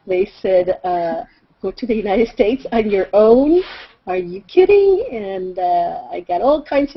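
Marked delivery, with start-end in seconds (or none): 1.6-5.0 mock-incredulous parent, pitch leaping up; louder, scandalized question rising high at the end; flabbergasted. Parents reacting to daughter's college plan, flabbergasted disbelief. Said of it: "they were just flabbergasted. They had a fit".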